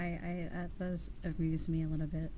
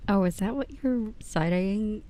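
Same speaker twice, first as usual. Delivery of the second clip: ordinary talk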